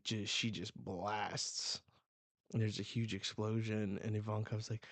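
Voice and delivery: usual speaking voice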